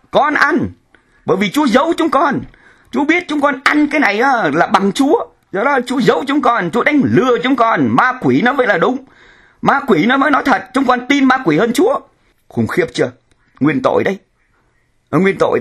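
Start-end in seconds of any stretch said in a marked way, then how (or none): 0.1-12.0 higher, a touch of defiant sinners talking back; preacher lightly voicing Adam and Eve defying God. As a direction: voice the first couple answering God higher and bolder.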